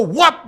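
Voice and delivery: a loud, high, sudden "Whap!" like a blade striking; mock chop sound. Host telling callers diabetics lose their feet, loud mock chop sound. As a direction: slap the sound out hard and fast.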